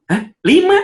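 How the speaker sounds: put-on mother scoffing at a child's fifth place; shocked mother. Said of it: "Ibunya meni- gampang ngomong".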